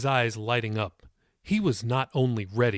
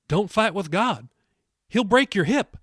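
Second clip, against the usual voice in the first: put-on high-pitched old lady delivering a punchline; joking warning; 9 semitones higher, 5 dB louder than usual. A narrator's reading. The elderly lady gives Jacob her second lesson, high joking warning. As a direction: warn him in a raised old-lady voice.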